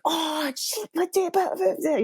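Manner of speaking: pained outburst. Retold or imitated. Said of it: "So I was like".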